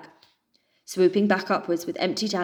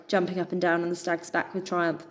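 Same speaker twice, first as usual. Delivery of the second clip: plain reading voice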